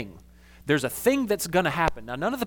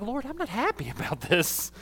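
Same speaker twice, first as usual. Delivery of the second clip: higher and softer, laughing through it; changed, softer tone; 7 semitones higher, 4 dB quieter than usual. Shown as a demonstration. Pastor retrying his complaint to God, softer with a laugh. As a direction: sweeten the same complaint with a chuckle. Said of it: "I just sort of change my tone".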